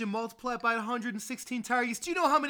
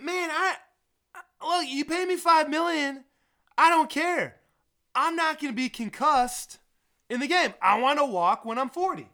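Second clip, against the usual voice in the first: higher, louder mock player whining; imitating Kyle; 5 semitones higher, 5 dB louder than usual. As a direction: play the fed-up player, a touch pleading. Kyle's voice in a retold quote.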